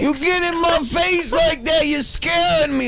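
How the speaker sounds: mock scared yelp. Startled by a player in his face, high mock fright. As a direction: yelp like someone jumped in your face.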